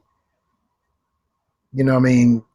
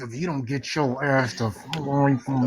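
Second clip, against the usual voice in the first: through gritted teeth; 7 dB quieter than usual. Retold or imitated. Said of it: "you talking through your teeth".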